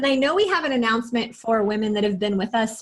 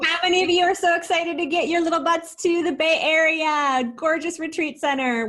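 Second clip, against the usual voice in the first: high, eager rallying question; bubbly event promoter; excited hype; 6 semitones higher than usual. Real, not acted. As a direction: hype up the group, pitched high. Co-host hyping up the retreat.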